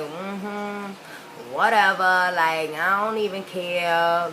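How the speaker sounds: slow, drawn-out "whatever" indifference; mock too-cool kid, dragging out the words; dismissive preteen attitude. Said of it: "that type of attitude".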